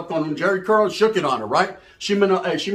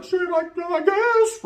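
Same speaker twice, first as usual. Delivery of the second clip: much higher, slow unsure shrug; reluctant high squeak; 11 semitones higher, slower than usual. Retold or imitated. Comedian voices himself agreeing to share, high and slow. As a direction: squeak it out, not sure at all.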